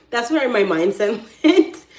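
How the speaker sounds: loud, slow, through laughter; amused, emphatic outburst; laughing. Real, not acted. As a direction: burst out laughing as you talk. Laughs at own reasoning.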